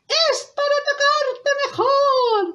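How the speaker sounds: high, slow fake-granny voice; wolf as grandmother. Wolf in disguise answering the girl, high drawn-out voice. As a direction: draw it out high, playing granny. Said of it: "como si fuera la abuelita".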